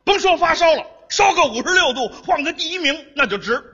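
loud, insistent dad; pushy father. Acted. Pupil's father urges the tutor on despite fever, pushy father. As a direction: push the teacher on, loudly. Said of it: "我是他爸爸".

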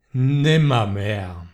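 low, slow, hollow croak of a bird; raven's answer. Acted. The raven answers the pleading man, slow and low.

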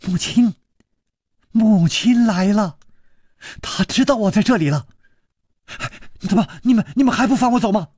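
put-on high, eager girl's voice, rushing ahead; a girl thrilled to hear her mother; overjoyed girl. Girl hearing her mother arrive, high overjoyed voice. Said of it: "大喜".

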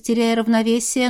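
plain reading voice

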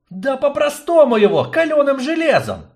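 high, loud exasperated outburst. Acted. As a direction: blurt it out, losing patience. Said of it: "не выдержал Аратарн".

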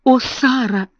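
high, plaintive sigh of a name; on the verge of tears; helpless, tearful appeal; nearly crying. A narrator's reading. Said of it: "чуть не плача сказала мисс Амелия".

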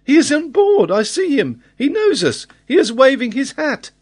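very high, loud and thrilled; boy's excited shout. A narrator's reading. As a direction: cry the news out like an excited lad. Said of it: "the lad shouted".

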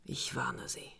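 leise, drohend geflüstert; geflüsterte Drohung. Acted. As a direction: zischt ihm eine Warnung zu. Leise Drohung der Frau. (Frau droht dem Detektiv flüsternd mit der Waffe)

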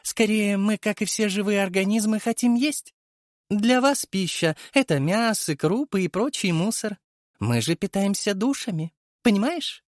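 high, mocking creature voice; sneering worm king voice. A narrator's reading. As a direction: voice an amused parasite villain. Villainous worm king speaks.

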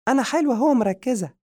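very high, loud squeaky comeback; quick retort. Acted. Madiha retorting to the pious man, high and cheeky. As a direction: snap back fast in a high cartoon voice. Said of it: "ردت مرة أخرى".

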